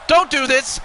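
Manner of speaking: loud, rising commentator shout; excited shout. Real, not acted. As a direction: yell it as the move lands. Live wrestling play-by-play.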